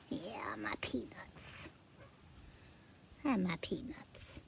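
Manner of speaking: soft whispered coo; whispering. Real, not acted. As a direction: whisper it gently. Whispering to pet.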